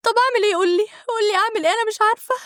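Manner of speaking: high-pitched, crying plea for help; tearful pleading. A narrator's reading. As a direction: plead helplessly as if bursting into tears. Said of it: "بدأت أعيط وقلت له".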